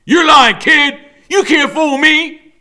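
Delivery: very loud, high yell; villain shouting. A narrator's reading. Killer Kane shouting at Freak, loud. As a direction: bark it at the kid.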